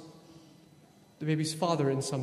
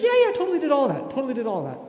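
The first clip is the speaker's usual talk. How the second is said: much higher, louder and fast, overeager; eager rule follower; 9 semitones higher, 7 dB louder, much faster than usual. Retold or imitated. Rich young man boasting to Jesus, eager and high. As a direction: gush like a kid showing off. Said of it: "And he's like".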